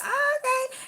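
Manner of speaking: squeaky high oh okay; a startled high oh; high-pitched nervous oh okay; mock surprise. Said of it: "And I was like".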